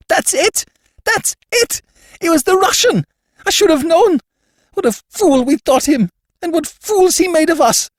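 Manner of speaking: high, loud, spluttering hysterical shriek; shrill spluttering scream. A narrator's reading. Levantine to Gutman, finding the fake, shrill scream. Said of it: "in a shrill, spluttering scream".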